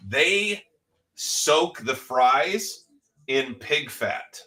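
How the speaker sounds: drawn out, word by word; slow emphasis. Real, not acted. Revealing how the fries are cooked, slow and emphatic. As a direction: spell it out slowly for effect. Stressing a shocking fact about fries.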